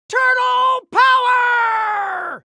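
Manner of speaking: high, loud, long-held rallying yell; battle cry. Acted. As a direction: yell it high and hold the last word.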